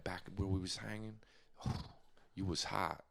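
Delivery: low, hushed seduction voice; smooth seducer. Acted. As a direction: drop it way down low and soft, coaxing. Demonstrates smooth seduction talk for Brian.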